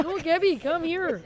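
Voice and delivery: high, loud mocking mimic of a friend; hammed-up high call to Gabby; imitating Megan. Mocking Megan's call to Gabby, high and loud. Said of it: "That's how you sound, Megan".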